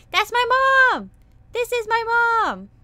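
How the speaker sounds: loud, high excited yell; excited shout. Real, not acted. Vtuber shouting as her model artist appears. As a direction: shout it with delight. Excited about chat message.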